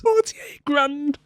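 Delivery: drawn-out high gasp of a price; stunned echo. Real, not acted. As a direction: repeat it like you can't believe the cost. Echoing a shocking price.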